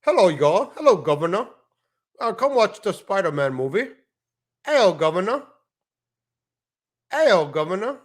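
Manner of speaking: exaggerated British caricature; mock British accent. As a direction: tease with a cartoonish British accent, lilting up at the ends. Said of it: "I didn't know Spider-Man was British".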